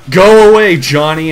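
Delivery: loud, high mock sibling yell; hammed-up annoyed shout; annoyed twin sisters. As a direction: shout him away like a cartoon sister. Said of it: "pretend I did that in unison".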